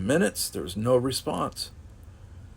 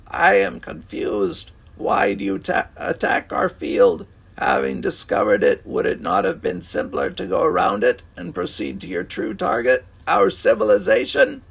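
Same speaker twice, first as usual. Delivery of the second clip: alien rasp; 8 semitones higher, 4 dB louder than usual. A narrator's reading. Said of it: "was little more than a rasp".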